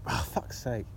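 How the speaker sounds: usual speaking voice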